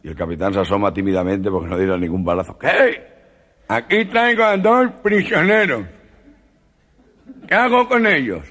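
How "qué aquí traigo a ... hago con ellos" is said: high, nasal put-on voice, slow; high comic nasal voice; gangoso voice. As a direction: speak like a man with a cleft palate. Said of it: "la voz del gangoso".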